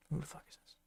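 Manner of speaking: quiet. Real, not acted. Players react to newcomer.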